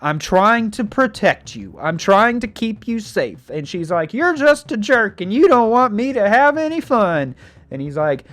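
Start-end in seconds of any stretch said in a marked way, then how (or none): 0.0-3.5 raised, higher exasperated parent voice; acted parental telling-off; scolding dad. 4.1-7.4 high-pitched mock teenage whine; hammed-up daughter protest; bratty teen. Acted teen daughter talking back.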